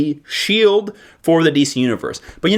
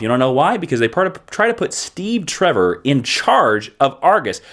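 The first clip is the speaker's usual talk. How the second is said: ordinary talk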